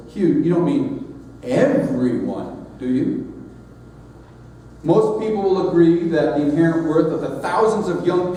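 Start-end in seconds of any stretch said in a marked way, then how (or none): 0.1-3.3 slow, doubting stress on "Hugh, you" at the start; loaded emphasis. Retold or imitated. Skeptical congregants questioning Hugh, slow loaded emphasis. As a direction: lean hard on "Hugh, you", then let the question hang. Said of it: "with a loaded emphasis on the first two syllables".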